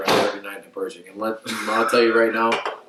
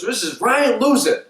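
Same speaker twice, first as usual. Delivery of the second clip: high, slow, raised public call-out; retold coach's announcement; 12 semitones higher than usual. Coach showing up a player before the team, mock announcing. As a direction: imitate a coach singling out a player.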